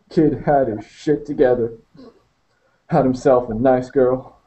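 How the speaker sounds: Maurice talking. Acted. Maurice talks in the van.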